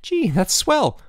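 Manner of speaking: high, eager exclamation; delighted. A narrator's reading. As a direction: gush with boyish delight. Roommate delighted at news of illness.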